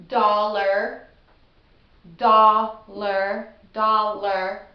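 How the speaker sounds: dollar said loudly, beats split; loud, rhythmic dollar repeated; syllable beat demo. Shown as a demonstration. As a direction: punch out dol-lar in two beats. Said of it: "does have two syllables".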